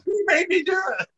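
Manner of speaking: put-on high, whiny child's excuse; whiny kid voice. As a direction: whine it like a caught little brother. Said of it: "I love the brother".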